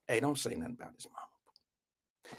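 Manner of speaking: very soft, low murmur; trying to whisper. Retold or imitated. Boy privately warning a girl about the mother, hushed and low. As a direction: discreet warning, barely projected. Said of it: "I'm trying to whisper".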